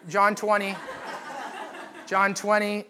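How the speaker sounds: playful nudge to the stragglers, laughing; chuckling as he calls it out again. Real, not acted. Teasing slow page-turners, repeats reference.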